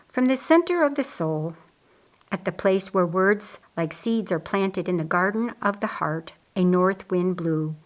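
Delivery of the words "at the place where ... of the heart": ordinary delivery, nothing marked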